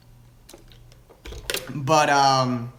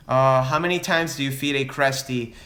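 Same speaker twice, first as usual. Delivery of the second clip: ordinary delivery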